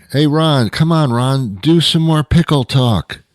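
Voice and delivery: high, loud call from the crowd; mock fan shouting a request; audience heckler. Voices a heckling audience member.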